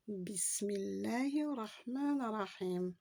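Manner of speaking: slow Arabic recitation of Bismillahir rahmanir rahim. Shown as a demonstration. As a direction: recite it slowly, each word drawn out. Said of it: "Commençons d'abord par". (récitation lente en arabe)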